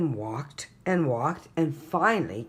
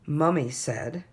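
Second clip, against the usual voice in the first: plain reading voice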